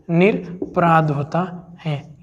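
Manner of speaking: slowly, word by word, teaching the correct form; slow model reading. Shown as a demonstration. Teacher to exam students, correct word read slowly. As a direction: spell it out slowly so students catch each syllable.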